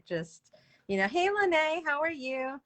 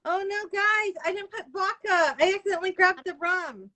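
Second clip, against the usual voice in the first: mock dismay; 9 semitones higher, 6 dB louder than usual. Real, not acted. Realizes she poured the wrong alcohol.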